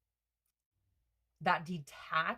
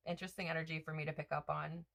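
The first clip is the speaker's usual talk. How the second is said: normal talking voice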